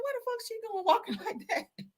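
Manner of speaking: squealed high through laughter, repeating the remark; high squeal laughing. Retold or imitated. To the audience, about the witness's walk, a high laughing squeal. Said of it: "I said".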